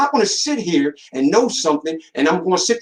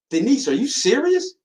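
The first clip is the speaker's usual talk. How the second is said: retold disbelief; 8 semitones higher than usual. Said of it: "I said".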